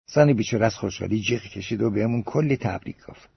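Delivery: ordinary delivery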